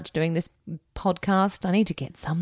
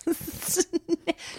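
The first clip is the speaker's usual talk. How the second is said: high laugh; 11 semitones higher, 6 dB quieter than usual. Real, not acted. Laughing at mispronunciation.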